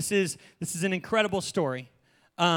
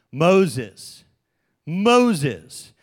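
booming call; 4 semitones lower, 7 dB louder than usual. Acted. God calls from the bush.

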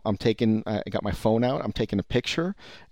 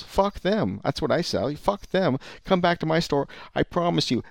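higher-voiced mock salesman's scorn; acted defiant pitch; 6 semitones higher than usual. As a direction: rubbish the rival and woo the customer, pitched up. Acted rival store winning over customer.

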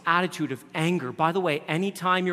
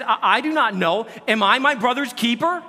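defiant Cain; 9 semitones higher, 10 dB louder than usual. Acted. Cain's defiant reply read aloud.

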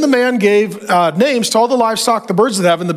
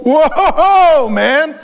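a sudden high, louder whoa; surprised whoa; 4 semitones higher, 3 dB louder than usual. Real, not acted. Preacher reading Genesis aloud, surprised by the word woman. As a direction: cut in on yourself, struck by the word.

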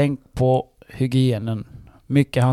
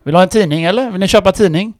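high, loud mock newspaper seller; playful salesman act at grandpa's door; paperboy joke voice; 7 semitones higher, 6 dB louder, faster than usual. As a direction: ring the bell and hawk a paper cheekily. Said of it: "så brukar jag alltid säga".

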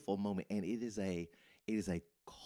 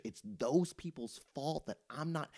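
higher, complaining, overwhelmed voice; agitated Martha; 7 semitones higher than usual. Retold or imitated. Martha complaining about her sister to Jesus, agitated. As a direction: blame them in a frazzled, higher whine. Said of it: "She's agitated. She's overwhelmed".

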